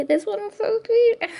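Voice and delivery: high, slow, squealing delight. Real, not acted. Showing a sweet coloring page, gushing in a high squeal. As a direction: gush over the page like it's a puppy.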